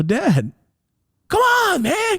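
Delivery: very high, loud whiny plea; desperate pleading. Acted. Son who knew he was fucked, pleading with dad. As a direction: beg in a strained, high voice. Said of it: "I was trying to plead".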